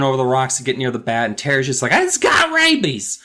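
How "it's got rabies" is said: panicked warning. Retold or imitated. Terry warning Jerry off the bat.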